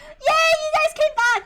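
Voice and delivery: very high, loud cheer; excited cheer. Real, not acted. Streamer cheering as chat reconnects, high and loud. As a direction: cheer with relief at the top of your voice.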